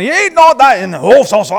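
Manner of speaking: high, loud gibberish imitating the interpreters; mock foreign translation. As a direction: babble it like a translator talking over you.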